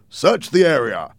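officer's projected command; police order. Acted. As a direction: call out an order to your men. Policeman orders his men.

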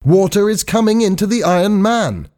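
high-pitched warning; alarmed shout. A narrator's reading. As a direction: cry out the danger, pitched high. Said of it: "shouted Hal again".

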